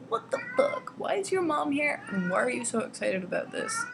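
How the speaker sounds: imagined freak-out, high at first, settling down; mock disbelief, starting high. Reader imagining her reaction to his mom being there, freaked out.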